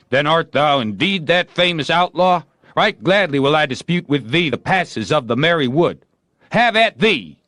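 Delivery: high, loud, rising battle cry; eager duelist. A narrator's reading. Joe as Guy of Gisborne starting the sword fight, loud. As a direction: throw down the challenge.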